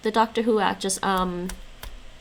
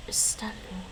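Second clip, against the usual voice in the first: whispered repeat; 8 dB quieter than usual. Shown as a demonstration. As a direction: whisper the word Istalum softly, unvoiced. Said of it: "Istalum Alapa".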